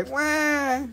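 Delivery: high-pitched pretend wail; mock crying wail. Seller teasing himself about crying over cheap prices, mock wail. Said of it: "Don't cry".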